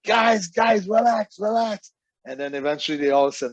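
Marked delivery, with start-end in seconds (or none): 0.0-1.9 high, urgent mock shout; imitated frantic pleading. Booker all excited in the dressing room, imitated frantic pleading. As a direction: plead high-pitched, as if losing it. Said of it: "He goes crazy".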